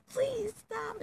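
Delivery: high, squeezed little plea; pleading. Retold or imitated. Girl pleading as a boy kisses her, high-pitched plea. Retold plea during unwanted kiss.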